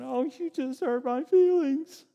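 hammed-up wounded complaint; mock whiny God. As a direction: whine like someone offended. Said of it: "God is not up in heaven going".

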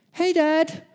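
high-pitched put-on son's voice; high-voiced greeting. Jacob's slip posing as Esau.

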